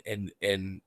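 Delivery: ordinary delivery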